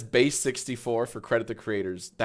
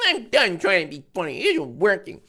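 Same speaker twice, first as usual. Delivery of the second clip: mocking whiny voice, pitched up; 6 semitones higher than usual. Mock whine reading a pathetic tweet aloud. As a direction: whine it out like a sad poster. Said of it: "so whiny, such pussy-coated energy".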